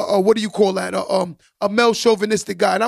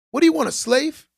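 incredulous; 6 semitones higher than usual. Real, not acted. Repeats the scoff, pitched up.

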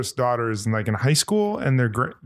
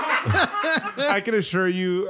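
laughing; 6 semitones higher, much slower than usual. Real, not acted. Laughs at Kim remark.

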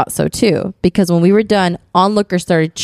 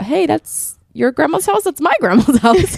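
surprised realization; 9 semitones higher than usual. Pretend. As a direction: gasp it out like a shocked cousin. Imagined cousins realizing kinship.

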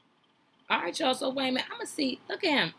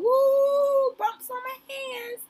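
mock shiver; 8 dB louder, much slower than usual. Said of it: "I mean, it's cold".

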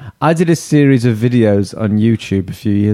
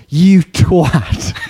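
raised, mock-Scouse insult; imitated angry Scousers; 5 semitones higher than usual. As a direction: hurl it as an angry Scouse commenter. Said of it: "would get really angry were".

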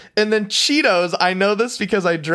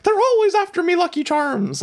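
high, light mock Irish leprechaun; a leprechaun's Irish lilt, played up; Irish accent; 11 semitones higher than usual. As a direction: chirp it in a cartoon Irish accent. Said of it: "in the accent".

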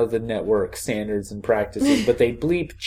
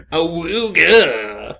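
goofy awooga call; 5 semitones higher, 4 dB louder, much slower than usual. Pretend. Goofy radio jingle noise.

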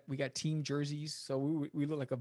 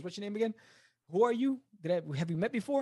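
lightly mimicked forgetful promoter, higher pitched; 7 semitones higher, 5 dB louder, faster than usual. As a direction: retell his blank questions, pitched up, as if meeting a stranger. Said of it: "[name] with A D D to the hundredth degree".

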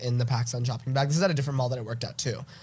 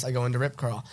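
ordinary delivery

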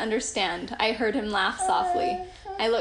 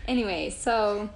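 ordinary talk

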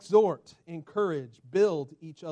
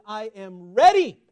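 ordinary delivery

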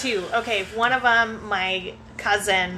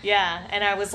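ordinary talk, nothing marked

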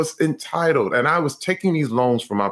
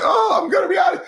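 mock panic. Athlete handed a broom, crying out in mock panic. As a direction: yelp in mock terror at the broom. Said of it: "I was gonna pass out".

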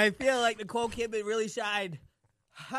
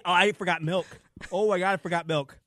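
loud panic; 10 dB louder than usual. Real, not acted. Panicked over the burn.

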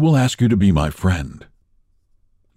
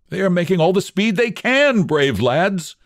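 much higher, glad praise; gladly; 11 semitones higher than usual. Acted. Ulrich praises his approaching men, glad voice. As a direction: cheer the men on with gladness. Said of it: "said Ulrich gladly".

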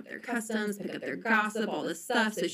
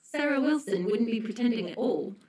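higher-pitched put-on English accent; British accent; 3 semitones higher than usual. Shown as a demonstration. Podcast guest asked for best foreign accent, British accent. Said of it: "hear your best foreign accent".

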